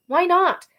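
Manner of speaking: high, loud demand; sharp challenge. Acted. Arrow challenging Badge, loud and high. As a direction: fire it back at her.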